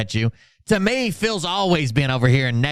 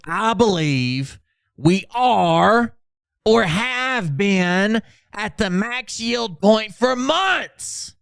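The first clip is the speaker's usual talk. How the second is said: slow, rising higher, mocking voice; mocking Phil; 8 semitones higher, much slower than usual. Streamer reading Phil's post in a slow, rising mock voice. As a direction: stretch it out, climbing into a silly high voice. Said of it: "Red Hen obviously meant maximum yield".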